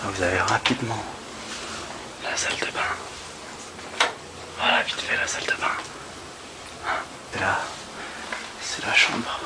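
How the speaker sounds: hushed whisper so roommates keep sleeping. Real, not acted. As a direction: whisper softly, voiceless. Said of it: "Je peux pas parler fort".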